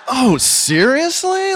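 high, slow, drawn-out disbelief; a hammed-up incredulous friend; mock disbelief. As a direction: stretch it out in fake shock. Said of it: "it was like".

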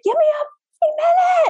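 very high, strained cry for a minute; exasperated mom reliving the moment, voice shooting up; strained plea. Retold or imitated. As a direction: squeeze it out like you're at your limit. Retold stressed mom outburst.

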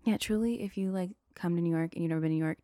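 ordinary talk

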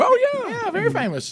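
high-pitched mock TV co-host; Kelly, faking recognition. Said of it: "give Kelly credit for faking".

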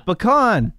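"Pecan" as puh-KAHN, weight on the second syllable; puh-KAHN pronunciation. Shown as a demonstration. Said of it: "Is it pecan or pecan".

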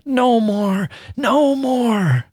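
high anguished wail rising on each no. A narrator's reading. She begs the dying man not to light his candle, high wail. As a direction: cry it out as horror takes her. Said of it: "she wailed".

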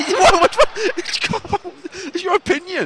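high, loud indignant squeal; mock outrage. Real, not acted. Co-host objecting to his friend with a high, loud squawk. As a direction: squeak out your disbelief.

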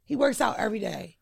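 usual speaking voice

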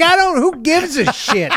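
high, exasperated comeback with a laugh; exasperated retort. Retold or imitated. Acting own exasperated reply to girlfriend.

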